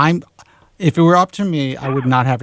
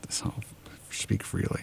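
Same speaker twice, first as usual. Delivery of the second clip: mocking whisper; 5 semitones lower, 18 dB quieter than usual. Real, not acted. Host sneers at the caller's complaint in a whisper. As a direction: whisper the phrase with scorn.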